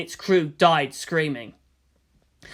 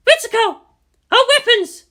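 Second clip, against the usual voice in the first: much higher, much louder call; urgent shout; 9 dB louder than usual. Acted. Commander shouting to Ritsuko about weapons, urgent. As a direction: yell for her over the chaos.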